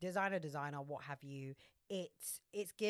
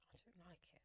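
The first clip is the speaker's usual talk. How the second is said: barely audible whisper; hushed, voiceless admission; whispered confession; 23 dB quieter, faster than usual. Real, not acted. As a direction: whisper it like a guilty secret.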